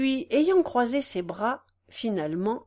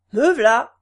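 loud call from the crowd; answering call; 10 dB louder than usual. A narrator's reading. Second peasant answering, loud. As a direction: call back from the benches. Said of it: "dit une autre voix".

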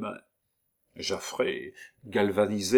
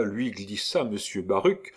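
ordinary delivery, nothing marked

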